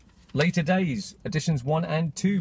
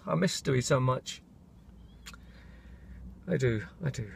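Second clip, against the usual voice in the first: emotional; 7 dB quieter than usual. Real, not acted. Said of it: "get a little bit emotional".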